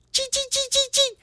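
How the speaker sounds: very high, sharp little squeaks; rapid-fire taunting chirps; critter chittering. Acted. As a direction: squeak teasingly like a tiny rodent. Little homies taunt from a bush.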